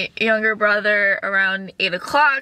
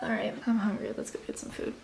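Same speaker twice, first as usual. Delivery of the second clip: whisper; 13 dB quieter, faster than usual. Real, not acted. Hushed, going to get food.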